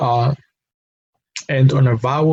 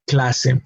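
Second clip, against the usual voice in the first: "clase" stressed on the first syllable, CLA-se; "clase" with strong cla, light se; correct penultimate stress; 3 dB quieter than usual. Shown as a demonstration. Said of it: "emphasis in this case should be".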